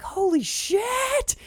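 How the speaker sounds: very high, drawn out slowly; stunned holy shit. Retold or imitated. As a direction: awestruck yelp. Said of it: "[name] is like".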